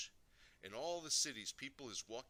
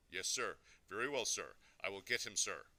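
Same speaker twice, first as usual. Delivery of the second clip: louder, dutiful yes-sir manner; cowed obedience; 3 semitones lower, 5 dB louder than usual. A narrator's reading. Father giving in to an important caller, cowed obedience. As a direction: submit to a superior on the phone.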